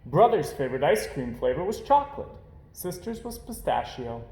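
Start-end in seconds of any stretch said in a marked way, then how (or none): none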